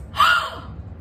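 outraged gasp, loud and high; a shocked intake of breath; sharp high gasp. Acted.

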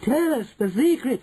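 high eager witch voice; impatient cry. A narrator's reading. Witches begging for the secret, impatient cry. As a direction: beg high, bursting to know. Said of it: "cried the audience impatiently".

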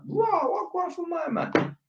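high, slow, baffled; mock surprise. Electrician reacting to being told not to call back, bewildered. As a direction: draw it out in disbelief. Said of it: "and I'm like".

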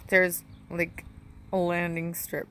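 usual speaking voice

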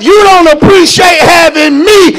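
forceful, emphatic pulpit delivery; full-force preaching. Real, not acted. Preacher challenging the congregation, full-force preaching. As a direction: preach it hard, driving each phrase home.

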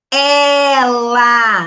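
"ela" loud, with the E wide open. Shown as a demonstration. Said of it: "O som do E é aberto".

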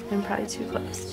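ordinary talk, nothing marked

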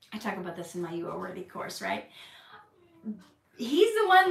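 usual speaking voice

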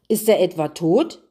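ordinary delivery, nothing marked

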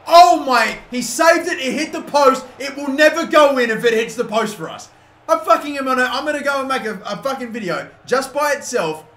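high, loud outburst of disbelief; exasperated shouting. Real, not acted. Gamer denied by the post, ranting in exasperation. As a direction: rant loudly at the rotten luck. Shot saved onto the post.

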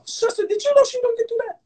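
put-on high, wailing old lady voice; old church lady. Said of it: "act like the old ladies".

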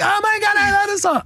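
squealing high "oh my God" fangirl; excited fangirl. Retold or imitated. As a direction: mimic a girl losing it over her song. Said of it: "she's like".

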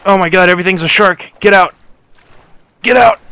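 loud high fast terrified shouts; panicked screaming. Real, not acted. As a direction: scream it, the shark is on you. Shark attack, screaming in panic.

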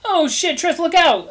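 alarmed high shriek; panicked scream. Acted. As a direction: yell the warning in terror. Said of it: "Al screamed".

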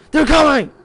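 shouted warning, high and loud; loud announcement. Acted. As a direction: call it out as a threat. Game character announces hunt.

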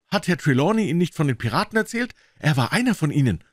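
put-on high, quick, eager boy voice; aufgeregter Junge. A narrator's reading. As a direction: blurt it out high and fast. Boy eagerly informs Silver. (aufgeregte, hohe Jungenstimme)

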